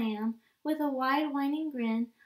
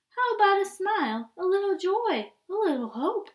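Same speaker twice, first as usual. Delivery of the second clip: higher, louder upbeat coaxing; bright coaxing; 5 semitones higher, 5 dB louder than usual. Acted. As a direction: perk him up with a smile. Squid urging smile.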